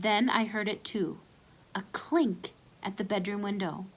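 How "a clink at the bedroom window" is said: plain reading voice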